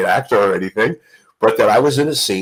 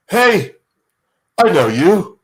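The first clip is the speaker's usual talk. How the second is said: lightly Liverpudlian Paul, higher and friendly; a hint of Paul recognising a fan; [name] imitation; 7 semitones higher, slower than usual. [name] spotting Roy at the reception, light Liverpool lilt. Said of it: "your [name] imitation".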